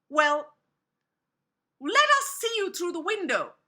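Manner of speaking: put-on high, loud little-kid voice; wary kids. A narrator's reading. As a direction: demand it loudly as a suspicious child. Kids demand to see visitor.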